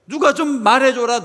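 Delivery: high, loud mock plea for someone to speak up; urgent pleading shout. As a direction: cry out for help on the lame man's behalf. Voices lame man's plea.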